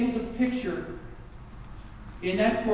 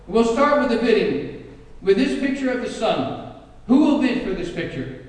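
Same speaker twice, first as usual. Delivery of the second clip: announcer call; 4 semitones higher, 5 dB louder than usual. Acted. Auctioneer opens bidding loudly.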